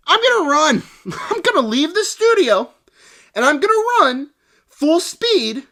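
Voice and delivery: high, mocking heroic voice; hammed-up heroine declaring; mock heroic declaration. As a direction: ham it up like a foolish hero. Mocking Sydney's running decision.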